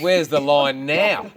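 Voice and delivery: loud, slow retold challenge; emphatic, drawn-out comeback; triumphant challenge. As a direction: throw the question out loudly, drawing out the words. Comedian's triumphant challenge in retold argument.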